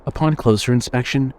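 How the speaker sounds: usual speaking voice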